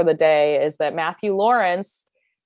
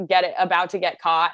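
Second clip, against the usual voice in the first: ordinary talk, nothing marked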